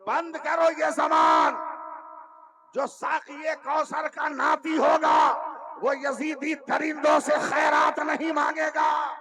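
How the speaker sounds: a preacher thundering Husain's defiant answer; furious shout. Acted. A preacher roaring Husain's reply to the Yazidis, furious and defiant. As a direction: roar it out high and loud, slow and defiant.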